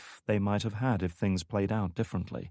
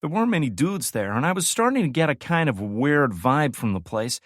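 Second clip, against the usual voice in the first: higher, louder lively woman's voice; Frost's voice, storytelling; 9 semitones higher, 6 dB louder than usual. Acted. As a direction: spin the yarn with relish.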